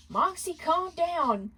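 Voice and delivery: high, slow plea; begging. Acted. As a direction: beg him to stop. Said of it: "Blitz begged".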